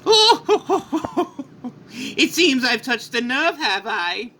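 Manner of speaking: high chuckling taunt; gloating villain laugh. Acted. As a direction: laugh mockingly, then needle your enemy. Villain mocks enraged hero.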